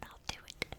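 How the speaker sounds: whispered with a little laugh; grandma whispering an offer in the dark. Retold or imitated. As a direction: whisper it while trying not to laugh. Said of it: "my grandma's like".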